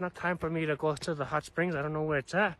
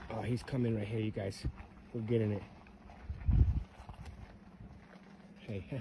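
low, softer muttering aside to the camera; hushed; 5 semitones lower, 10 dB quieter, faster than usual. Real, not acted. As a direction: keep it down so he can't hear. Stranger approaching, keeps voice down.

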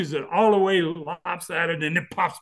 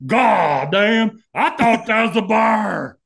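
put-on high, loud slow drunk voice; imitating a drunk; 6 semitones higher, 7 dB louder, slower than usual. Drunk startled by the bear at the red light, slow loud drawl. Drunk reacts in retold story.

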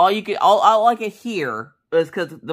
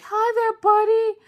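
put-on very high baby-talk voice; imitated puppy greeting; slower than usual. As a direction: coo it like greeting a tiny pup. Husband first greets the puppy.

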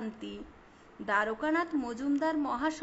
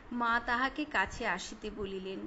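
usual speaking voice

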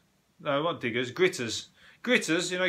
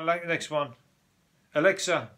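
ordinary talk, nothing marked

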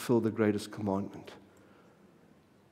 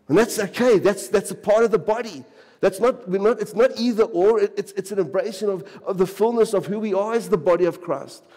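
excited preaching; 6 semitones higher, 3 dB louder than usual. Acted. Celebrating witnesses, lifted voice.

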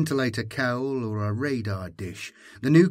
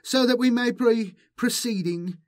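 very high-pitched herald voice; high character voice addressing a crowd; obsequious herald; 12 semitones higher than usual. A narrator's reading. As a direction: squeak it out as a small, officious character. Herald addresses pilgrims in story.

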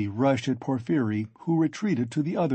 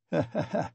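short needling laugh in character; acted chuckle; 5 semitones higher, 4 dB quieter than usual. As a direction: chuckle after the question. Porfiri laughs at his question.

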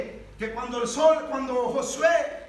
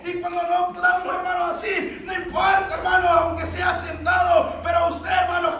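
high, raised, urgent exhortation; full-force preaching; 5 semitones higher, 6 dB louder than usual. Real, not acted. Preacher urges brothers to cry out to the Lord, full-force preaching. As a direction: urge the congregation on with force.

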